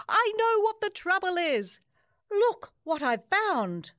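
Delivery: excited yell. Acted. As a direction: call out the discovery to the crowd. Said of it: "Jasper yelled".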